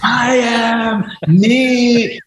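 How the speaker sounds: loud, obnoxious boasting, pitched very high; Michael Dorf. Retold or imitated. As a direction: crow it to the whole office in mock triumph. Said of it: "like, really loud and obnoxious".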